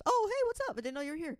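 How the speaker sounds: high-pitched mock surprised hello; excited greeting. Friends spotting each other at a show, high excited greeting. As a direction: greet them in a high, put-on chirp.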